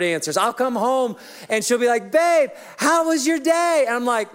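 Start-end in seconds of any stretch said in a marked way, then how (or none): 2.1-3.8 high, slow imitation of the wife; mock wife. Pastor imitates his wife greeting him at home, high and slow. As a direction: play the wife asking sweetly.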